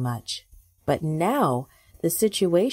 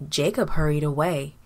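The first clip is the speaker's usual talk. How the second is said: ordinary delivery, nothing marked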